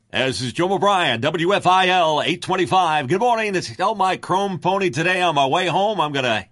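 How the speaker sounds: high, loud folksy Texas DJ; hammed-up good old boy radio host; Jim O'Brien impression. For the interviewer, doing his idol's DJ patter, high and loud. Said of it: "Can you do him?".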